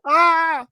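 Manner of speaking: high shriek of fright; mock scared yelp; startled scream. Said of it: "I was like".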